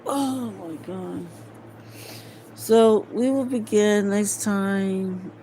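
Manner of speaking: normal talking voice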